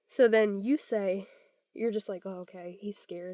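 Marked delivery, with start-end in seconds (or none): 2.3-3.3 much softer, quiet realization; a parent realizing the child is scared, quiet. Pretend. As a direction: drop to a hush as it dawns on you.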